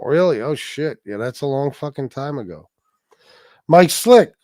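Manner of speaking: ordinary talk, nothing marked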